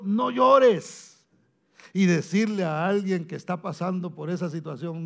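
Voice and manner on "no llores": raised, ringing no llores; firm comfort. Retold or imitated. Jesus tells the grieving widow, raised and ringing. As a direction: declare "No llores" with full preacher force.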